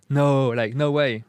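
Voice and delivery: loud, incredulous disbelief; surprised. Shown as a demonstration. Showing how Americans express surprise, loud disbelief. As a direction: react with loud amazement. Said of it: "can express ex-, uh, surprise".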